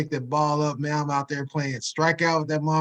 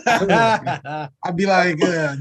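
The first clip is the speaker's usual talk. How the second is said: stretched out slowly through laughter; can barely get the words out for laughing; 3 semitones higher, 5 dB louder, much slower than usual. Real, not acted. Imagining being rich from the ball, laughing.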